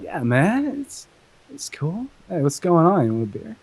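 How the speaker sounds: laid-back surfer dude. Retold or imitated. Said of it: "the surfer voice".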